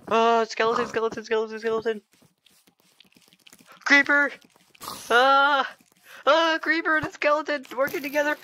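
very high, frantic squeal of alarm; high-pitched yelping under attack; panicked shrieking. Real, not acted. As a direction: cry out each threat in a high panic.

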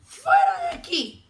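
high, loud storybook yell; grumpy shout. A narrator's reading. Grumpy ladybug chasing off the friendly one, shouting. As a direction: shout them away in a huff. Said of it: "le gritó la mariquita malhumorada".

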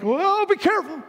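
high-pitched mock-worried cry; hammed-up high yelp of warning; alarmed call. Man lowered on ropes calls up.

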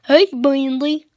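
loud, slow goofy cartoon greeting; drawn-out, dopey hail to a pal; Boris. Vlogger comparing Boris with his cartoon cousin, goofy voice. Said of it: "It's the same voice".

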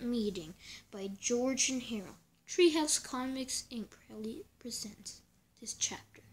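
dramatic whispered announcement. Acted. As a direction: whisper the title like a secret. Announcing the comic's chapter.

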